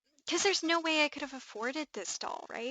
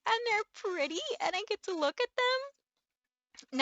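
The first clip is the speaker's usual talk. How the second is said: emotional; 9 semitones higher than usual. Real, not acted. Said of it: "I could for real cry".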